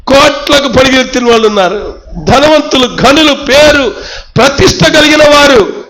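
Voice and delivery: a pleading, rising sermon voice; passionate preaching. Acted. Pastor confessing unworthiness before God, passionate preaching. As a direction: pour it out like a prayer.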